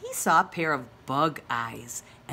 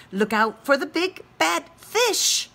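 higher, louder urgent warning; calling out warning; 9 semitones higher, 6 dB louder than usual. Acted. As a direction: shout it out to warn a child. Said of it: "cried Mommy Frog".

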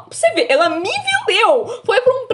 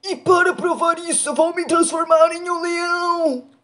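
ogre voice; slower than usual. Acted. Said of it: "Vou fazer agora pra você".

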